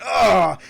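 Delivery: loud, high "ugh" of pain; acted groan of sudden pain; pained grunt. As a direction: cry out as if struck in the leg. Character hit by dagger.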